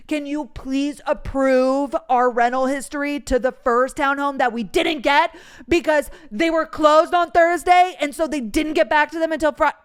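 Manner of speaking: loud, tense retelling with rising agitation; angst. Retold or imitated. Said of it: "again, a lot of angst".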